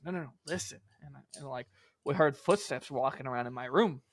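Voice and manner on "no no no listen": hushed insistence. Retold or imitated. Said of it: "And I was like".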